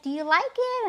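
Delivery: high, light imitation of her daughter; mimicking her daughter; daughter's question. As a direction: ask it in a lifted, girlish voice. Daughter voiced asking about haircut.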